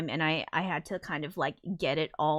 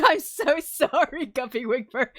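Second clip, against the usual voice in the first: laughing; 6 semitones higher, 5 dB louder than usual. Real, not acted. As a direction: giggle the apology out. Laughing apology.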